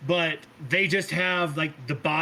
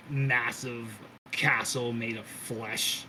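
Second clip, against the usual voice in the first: normal talking voice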